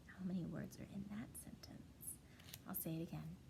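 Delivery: soft whispered question. Acted. Teacher quizzes class softly.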